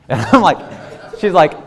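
high, loud laughing talk; real laughter breaking in. Real, not acted. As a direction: laugh through the words. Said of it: "laughing mid-story".

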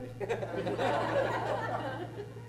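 high soft laugh; real laugh. Real, not acted. Preacher laughing at his congregation's reaction, soft giggle. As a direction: giggle at his own joke.

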